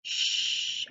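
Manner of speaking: a soft hushing "Sh"; sh sound demo; sh sound alone. Shown as a demonstration. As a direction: hold the sound "Sh" on its own, voiceless. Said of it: "makes a brand new sound".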